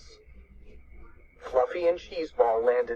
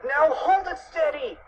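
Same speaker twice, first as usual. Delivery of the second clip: high, urgent cartoon yell; yelled order; 14 semitones higher than usual. A narrator's reading. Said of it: "yelled Fluffy".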